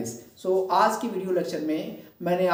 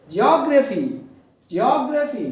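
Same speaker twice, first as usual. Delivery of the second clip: Geography as jee-OG-raphy, high on ओग; stress on middle syllable; 5 semitones higher, 5 dB louder than usual. Shown as a demonstration. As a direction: punch ओग in geography, then fall. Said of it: "ओजी पर क्या करेंगे". (Geography में ओग पर ज़ोर)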